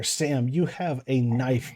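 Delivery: usual speaking voice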